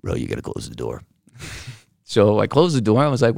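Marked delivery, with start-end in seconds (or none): 0.0-1.0 much lower, quick deep serious voice; deep Steve voice. Retold or imitated. Imitating Steve telling him to close the door, deep and low.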